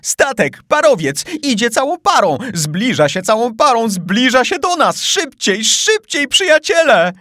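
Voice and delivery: high, loud, excited cries; excited urging. Acted. Paganel spurs his friends at the oars, excited shouting. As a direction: shout them on, thrilled. Paganel sights steamer, urges speed.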